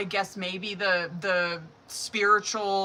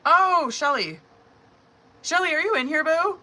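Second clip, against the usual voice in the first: high, raised sing-out to someone; calling out; 8 semitones higher, 5 dB louder than usual. Real, not acted. Host checking whether a viewer is present, calling out. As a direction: call for them brightly, pitched up high.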